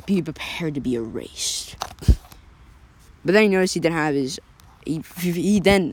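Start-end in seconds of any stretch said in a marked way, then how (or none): 0.1-1.8 low, slow, soft threat; menacing villain. Acted. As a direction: breathe the threat slowly.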